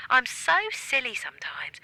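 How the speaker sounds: ordinary delivery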